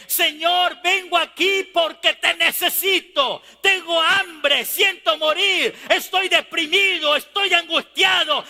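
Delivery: high, impassioned cry building to a shout; preaching plea. Acted. Sinner begging the Lord for help, impassioned preaching cry. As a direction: cry out urgently, stretching the words. Preaches a desperate prayer to God.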